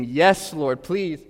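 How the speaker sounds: loud, slow, high pleading cry of joy; mock eager shout, yes Lord please; eager scream. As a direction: beg at the top of your voice, drawn out. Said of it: "light up and scream".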